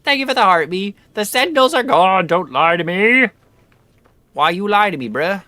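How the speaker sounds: high, loud mock outrage. Real, not acted. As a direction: yell at the game in disbelief.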